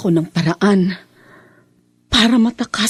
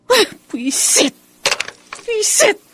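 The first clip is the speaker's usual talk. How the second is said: frustrated curse; 13 semitones higher, slower than usual. Acted. Frustrated, no dial tone.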